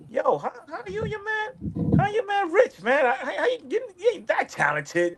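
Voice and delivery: high, needling mock interrogation; mocking question. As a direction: tease them about their sudden riches. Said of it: "I'm like".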